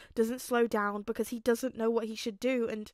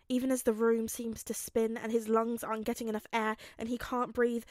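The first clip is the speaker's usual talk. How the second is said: ordinary delivery, nothing marked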